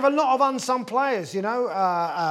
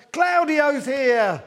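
excited cheer; 4 semitones higher, much slower than usual. Pretend. Everyone greeting him at party.

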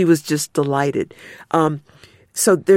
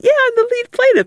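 a hint of the plaintiff's voice, high, fast and bright; lightly voiced plaintiff; 5 dB louder, faster than usual. Plaintiff revealing herself to the lawyer, high fast voice. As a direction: toss it off quickly like she's revealing a secret. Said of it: "And she went".

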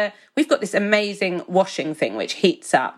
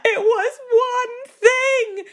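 high, slow, wailing mock sob; mock crying; slower than usual. Wife in a row with partner, mock crying and wailing. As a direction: wail it like a tearful row.

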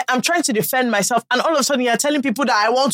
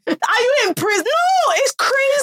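high, laughing disbelief; shocked and half laughing; incredulous; 12 semitones higher than usual. Real, not acted. Incredulous reaction to caning.

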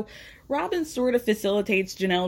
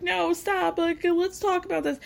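higher, pleading voice playing Robin; mock Robin pleading; 8 semitones higher than usual. Recapper imitating Robin trying to calm things, higher pleading. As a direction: imitate Robin begging to talk it out. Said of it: "and Robin's like".

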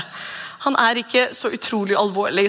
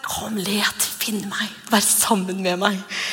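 tender invitation; slower than usual. Pretend. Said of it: "jeg tror han har den".

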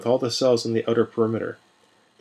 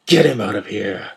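roar; 4 dB louder than usual. Acted. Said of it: "Manon roared".

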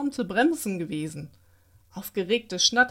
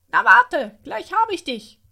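louder, higher playful threat; cheerful playful shout; 7 semitones higher, 9 dB louder than usual. A narrator's reading. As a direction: call out like a child at play in the snow. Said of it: "Fröhliche Stimmen riefen sich zu".

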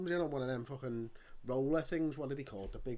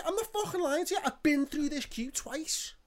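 high, loud and slowed; angry protest to the queue woman doubting him; indignant protest; 14 semitones higher, 6 dB louder than usual. Retold or imitated. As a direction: hammer home every word in anger.

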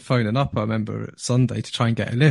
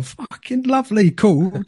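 much higher, cocky delight; gleeful; 9 semitones higher, slower than usual. Retold or imitated. To his mate as the other player goes all in, gleeful. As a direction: relish it, the pot is yours.

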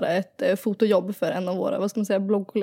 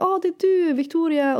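excited fan; 8 semitones higher than usual. Retold or imitated. Fans recognizing her abroad.

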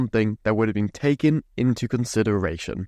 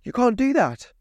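mocking mum; 11 semitones higher than usual. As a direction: squeak out a mum's flat no. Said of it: "She tells me".

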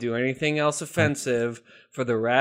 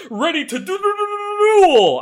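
stuttered rising "do, do, do" into a loud high "duel"; stuttering build; 10 dB louder than usual. Pretend. Quoting a card game to a cohost, stuttered and rising. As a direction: stammer up the pitch and slam "duel". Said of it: "I can't do the intentional stuttering".

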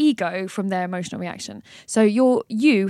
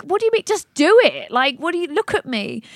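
incredulous outburst; 7 semitones higher than usual. Retold or imitated. A bleeding victim answering a useless offer, incredulous. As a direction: snap back in disbelief. Injured person outraged at offer.

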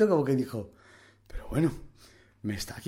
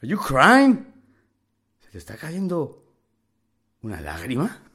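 mocking taunt; 9 dB louder than usual. Young inmate taunts crying tough guy, loud and jeering. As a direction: rub it in his face.

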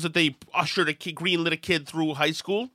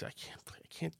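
whisper; 21 dB quieter than usual. Real, not acted. Gives up, laughing aside.

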